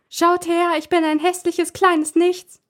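boy mocking the doll in a squeaky voice. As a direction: squeak it like a silly puppet. Boy mocks voicing the doll.

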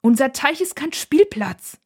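slow, high, indignant complaint; agitated motherly protest, drawn out; upset, exasperated. A narrator's reading. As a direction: lay down the rule, worked up. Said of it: "sagt ihre Mutter aufgebracht".

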